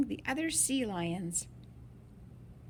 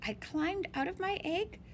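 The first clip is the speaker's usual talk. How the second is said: ordinary delivery, nothing marked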